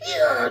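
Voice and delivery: high, loud mouth-made missile screech; screaming projectile sound effect; Scud missile sound. Retold or imitated. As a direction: imitate a missile streaking past. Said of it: "like a Scud missile".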